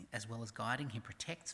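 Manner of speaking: ordinary talk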